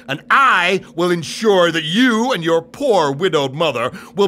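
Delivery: scheming uncle making a pitch; Abanazar. Acted. Abanazar coaxes Aladdin into the cave.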